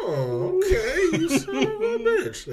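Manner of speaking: very high, loud, slow laughing; laughing mock exclaim. Reenacting a movie reaction for a friend, high laughing. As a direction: squeal the insult laughing.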